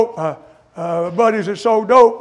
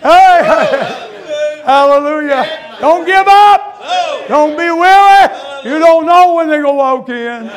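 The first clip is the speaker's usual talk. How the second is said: loud, higher preaching voice, shouting out; preaching at full force; 6 semitones higher, 8 dB louder, slower than usual. Acted. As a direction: build the sermon up to a fired-up holler. Preacher exhorts the congregation.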